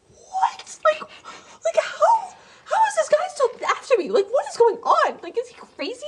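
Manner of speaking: high, squeaky, incredulous panic; stunned disbelief. Retold or imitated. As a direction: squeal it like she can't believe he's still chasing her. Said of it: "her face is like".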